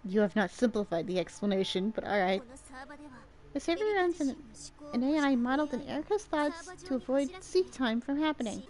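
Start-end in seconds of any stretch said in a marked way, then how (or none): 0.0-2.4 low deadpan voice. Acted. Deadpan reply to long explanation.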